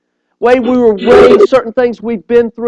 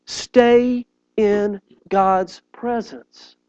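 solemn charge; 7 dB quieter, much slower than usual. Acted. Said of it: "sermon emphasis".

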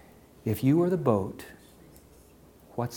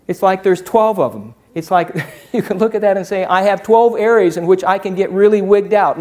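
loud, high, driving sermon voice; preaching at full force; 8 semitones higher, 11 dB louder than usual. Acted. Pastor firing up the congregation, loud preaching. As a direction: preach it hard to the whole room.

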